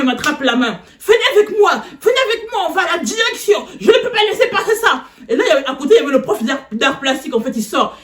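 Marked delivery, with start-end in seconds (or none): 1.1-5.1 hammed-up outraged teacher, raised and high; imitating angry teacher. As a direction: play the furious teacher dragging her off. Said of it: "Elle me dit".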